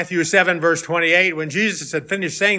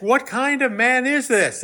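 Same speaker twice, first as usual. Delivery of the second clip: higher, drawn-out question of wonder; amazed question; 6 semitones higher, slower than usual. Acted. Amazed disciples asking about Jesus, mildly awed. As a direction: ask it slowly in mild amazement. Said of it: "The men were amazed and asked".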